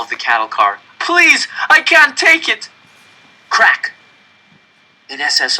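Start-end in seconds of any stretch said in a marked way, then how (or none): 1.0-2.7 very high, loud pleading; trapped man begging before the shot; desperate scream. Acted. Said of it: "started to scream". 3.5-3.9 gunshot crack. Acted. Gunshot described.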